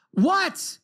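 high, fed-up reply; re-enacted irritated parent; exasperated snap. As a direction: fire it back upstairs, out of patience. Exasperated father answering repeated calls.

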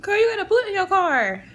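high-pitched imitation of a shocked friend; lightly hammed-up friend gasping the news; imitating her friend. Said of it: "So she's like".

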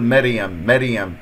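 مريم said quickly as meryem with a thin r; faulty, thin-voweled مريم; wrong light ra. Shown as a demonstration. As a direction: flatten مريم to a light mer-yem. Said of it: "هذا خطأ".

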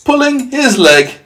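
very high, loud, drawn-out hoot; gleeful cry. Acted. Centipede in hysterics at the earthworm, crying high. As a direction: crow it in mock disbelief. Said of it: "he cried".

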